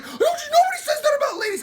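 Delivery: very high, loud squawk of indignation; mock outraged shriek. Offended public scolds the villain, shrieking in mock outrage.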